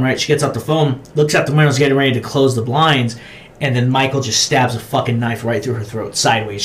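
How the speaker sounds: normal talking voice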